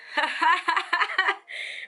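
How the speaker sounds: real, bubbling high laugh; burst of laughter. Real, not acted. Reader laughing at the tarot card she just drew, delighted laugh. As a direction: let a surprised laugh spill out. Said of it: "Why I'm laughing?".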